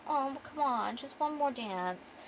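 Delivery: high, drawn-out wheedle; lightly voiced tipsy woman begging; pleading. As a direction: coax him, slow and high at the end. Drunk woman pleads to stay.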